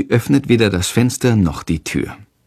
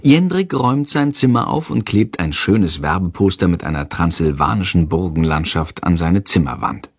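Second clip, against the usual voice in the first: ordinary delivery, nothing marked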